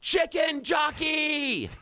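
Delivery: high, drawn-out movie battle cry. Retold or imitated. Radio host re-enacts the movie scene for co-hosts, imitated yell. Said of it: "and he yells".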